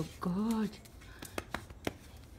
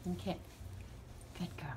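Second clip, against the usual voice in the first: whispering; 4 semitones lower, 12 dB quieter, faster than usual. Real, not acted. Praising dog softly.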